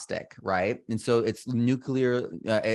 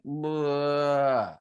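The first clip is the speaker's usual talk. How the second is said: pitched-up gagging bleh; disgusted bleh; 6 semitones higher than usual. Retold or imitated. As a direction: spit out a sick bleh. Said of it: "it's like".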